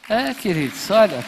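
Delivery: loud, high call to the church. Real, not acted. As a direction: rouse the congregation. Pastor calling out to congregation.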